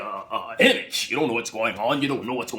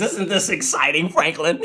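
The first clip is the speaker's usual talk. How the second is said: excited wife; 4 semitones higher, 4 dB louder than usual. Retold or imitated. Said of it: "and she says".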